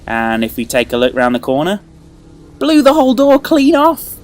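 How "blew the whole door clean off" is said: much higher and louder, delighted; thrilled. Real, not acted. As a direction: gush over the blown door. Door blown clean off.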